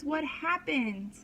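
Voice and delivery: usual speaking voice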